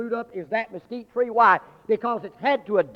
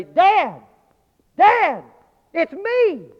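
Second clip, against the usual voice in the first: put-on much higher and loud child's voice; child calling out; 10 semitones higher, 11 dB louder, much slower than usual. Buried boy calls up to his father, high loud child cry. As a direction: call up from under the rubble as a small boy.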